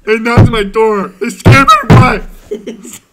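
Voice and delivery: exasperated complaint. Acted. Boss confronting employee about police at home, exasperated complaint. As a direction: build to a loud, stretched-out climax.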